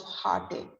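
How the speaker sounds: "heartache" as HART-ake, ending in a K sound; careful heartache. Shown as a demonstration. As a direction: say "heartache" with ache rhyming with take. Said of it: "be careful with the pronunciation".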